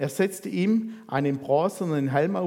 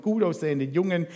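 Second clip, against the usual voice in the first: ordinary talk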